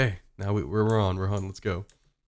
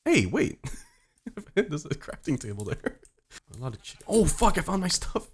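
normal talking voice

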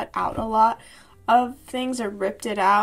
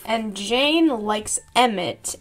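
ordinary talk, nothing marked

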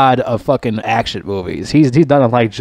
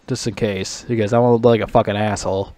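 normal talking voice

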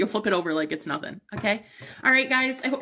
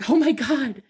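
sudden, high, loud oh my God; surprised gasp; 5 semitones higher, 4 dB louder than usual. Real, not acted. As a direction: caught off guard by what she sees. Sudden realization mid-reading.

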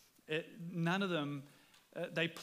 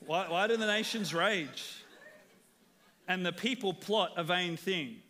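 scripture reading; 4 dB louder than usual. Acted. Reads psalm with emphasis.

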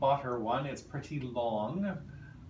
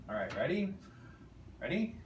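ordinary talk

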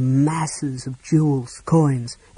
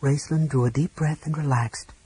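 ordinary delivery

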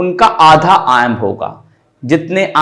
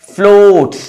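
"floats" raised high and drawn out; stressed syllable; 4 semitones higher, 4 dB louder than usual. Shown as a demonstration. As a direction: lift and hold "floats" as the strong beat. ("floats" पर ऊँचे सुर में खींचकर बलाघात)

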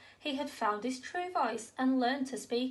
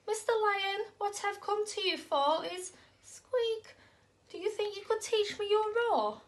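nervous squeaky mouse; 7 semitones higher than usual. Acted. Mouse asks lion nervously.

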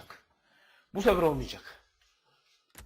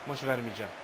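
low, soft determined mutter; quiet stubborn vow; 10 semitones lower, 8 dB quieter, faster than usual. Real, not acted. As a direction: say it to yourself under the breath.

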